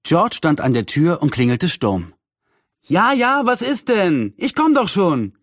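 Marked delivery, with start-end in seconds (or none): 2.8-5.4 high-pitched impatient call; voiced aunt calling out, pitched up. A narrator's reading. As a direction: call back that you're already coming. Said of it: "rief ihre Tante".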